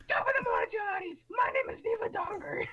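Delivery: very high, shouted Irish greeting; parody Irish intro. Acted. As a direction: yell it as an over-the-top Irish intro. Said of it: "could you imagine our intros?".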